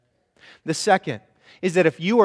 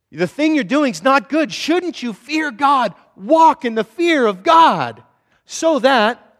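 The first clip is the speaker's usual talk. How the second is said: higher, louder, slowed appeal; urgent exhortation; 8 semitones higher, 5 dB louder, slower than usual. Acted. Preacher pressing Nehemiah's rebuke on the crowd, urgently. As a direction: press the question home, slowly. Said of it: "preacher paraphrases Nehemiah's rebuke".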